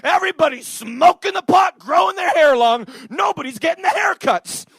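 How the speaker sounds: high, loud mock rant, building to a shout; ranting Italian barber. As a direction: imitate an old barber working himself up. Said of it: "He's like".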